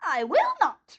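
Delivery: high, sharp witch's refusal; witch retort. A narrator's reading. Witch refusing Dorothy's demand, high and sneering. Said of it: "retorted the witch".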